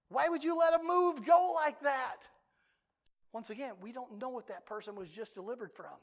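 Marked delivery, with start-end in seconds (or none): 0.1-2.3 mock complaint to God. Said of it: "I might tell the Lord".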